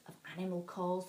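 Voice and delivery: usual speaking voice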